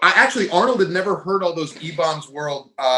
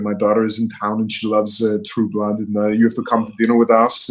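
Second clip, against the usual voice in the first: Arnold impression; 6 semitones lower than usual. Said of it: "if you have other impressions".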